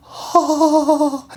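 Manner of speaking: high, startled "Oh."; a short startled sound; surprised oh. Retold or imitated. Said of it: "He's like".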